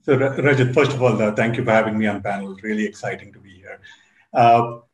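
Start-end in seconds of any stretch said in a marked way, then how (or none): none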